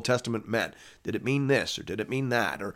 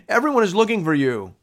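raised, higher-pitched quoted exclamation; lightly acted urgency while reading; urgent announcement; 8 semitones higher, 4 dB louder than usual. A narrator's reading. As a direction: call out the news to someone just found. Disciples find Jesus, voiced in reading.

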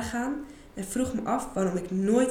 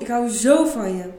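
passionate; 2 semitones higher, 8 dB louder than usual. Acted. Paul's pleasure declaration.

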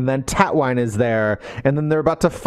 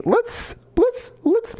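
squeaky stalling; much slower than usual. Acted. Wolfson stalling on witch offer.

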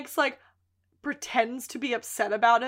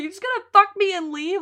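pissed yell; 8 semitones higher, 5 dB louder than usual. Retold or imitated. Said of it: "yelling at him. She's pissed".

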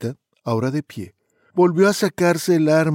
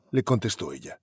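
ordinary delivery

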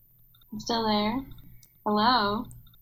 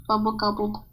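normal talking voice

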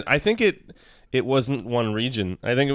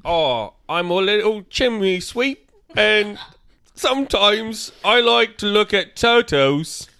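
higher, hammed-up British chimney sweep; terrible British accent; 8 semitones higher, 4 dB louder than usual. Reading a card as Lewis for friends, in a terrible, high British accent. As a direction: put on a bad British urchin's voice. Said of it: "can I read ... like, as Lewis".

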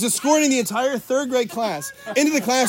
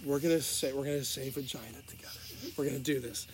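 a softer, steadying teacher voice; hushed teacher; 6 semitones lower, 11 dB quieter than usual. Retold or imitated. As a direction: settle the class down gently. Teacher calming class, hushed.

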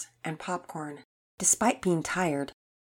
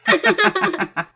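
high, loud burst of giggles; rapid high cackling laugh; convulsive laughter; 13 semitones higher, 9 dB louder than usual. A narrator's reading. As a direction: crack up like boys in fits of laughter. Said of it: "breaking out into convulsive laughter".

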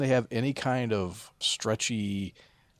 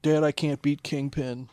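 put-on higher, louder pleading little kid; whiny kid; 6 semitones higher, 5 dB louder than usual. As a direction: beg dad like a small child. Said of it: "was like".

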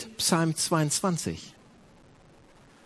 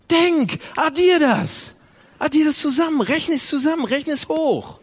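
urgent preaching shout; 12 semitones higher, 7 dB louder than usual. Acted. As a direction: drive each word home. Preacher urging to add up. (laut und eindringlich gerufen)

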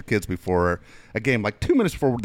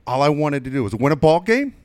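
building from raised to very high and loud; exasperated coach; 8 semitones higher, 6 dB louder than usual. Retold or imitated. Coach venting to the losing team, building to a loud peak. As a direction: wind up to an outraged peak.

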